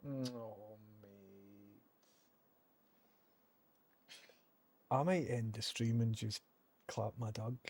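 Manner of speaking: holding back tears, quietly; nearly crying. Real, not acted. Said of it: "'cause I'm nearly greeting".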